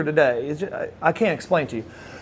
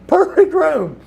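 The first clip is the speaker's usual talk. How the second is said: high, loud, laughing disbelief; incredulous echo; 12 semitones higher, 5 dB louder, slower than usual. Retold or imitated. Friend questioning paralyzed Lex's optimism, laughing and incredulous. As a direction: throw the words back, cracking up in disbelief.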